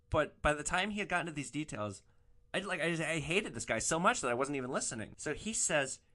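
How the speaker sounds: usual speaking voice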